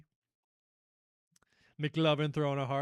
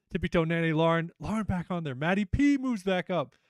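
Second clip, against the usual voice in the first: ordinary talk, nothing marked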